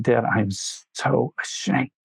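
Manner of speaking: soft, slow tearful plea, lightly imitated; retold sobbing son. A father retelling his ashamed son, speaking softly through mock tears. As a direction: quietly voice the son's shame, close to tears. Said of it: "crying heavily, sobbing".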